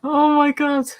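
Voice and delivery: a high squeal of a laugh, then a high gasp; shocked laugh. Real, not acted. Streamer watching the figures fall, laughing in high shock. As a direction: caught off guard as the figures tumble.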